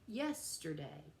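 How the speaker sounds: "yesterday" slowly, with YES stressed; stress on first syllable. Shown as a demonstration. Teacher showing a dactylic foot, "yesterday" slowly stressed on YES. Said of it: "with a stress on the first syllable".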